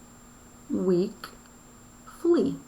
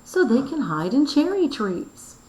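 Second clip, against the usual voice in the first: raised, louder, higher read of the line; exclaiming; 7 semitones higher, 4 dB louder than usual. Shown as a demonstration. Teacher reads Helen's riddle answer to students, exclaiming. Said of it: "she's yelling".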